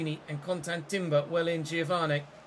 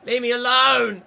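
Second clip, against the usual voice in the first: loud and high, fed up; angry shout; 9 semitones higher, 12 dB louder than usual. Real, not acted. Gamer shouting at defenders crowding him, angry shout. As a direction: yell it at the players hounding him.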